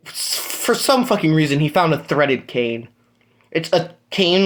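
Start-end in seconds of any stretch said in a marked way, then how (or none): none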